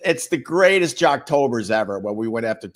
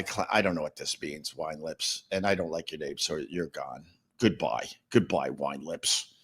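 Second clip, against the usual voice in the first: 1 dismissive low; 8 semitones lower, 9 dB quieter than usual. Real, not acted. Banning a chatter.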